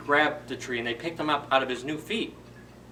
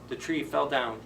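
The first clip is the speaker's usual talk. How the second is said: ordinary delivery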